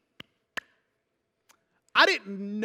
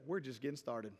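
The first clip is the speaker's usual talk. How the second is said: much lower and softer, deadpan reply; wife's calm comeback; 10 semitones lower, 16 dB quieter than usual. Acted. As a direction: undercut him quietly. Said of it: "She says".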